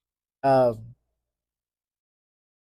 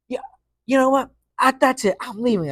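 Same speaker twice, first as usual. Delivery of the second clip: much higher and louder, imitating a furious teacher; teacher walking out; 11 semitones higher, 5 dB louder than usual. Teacher quitting mid-class, mock furious. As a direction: mimic her storming out. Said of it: "she just like".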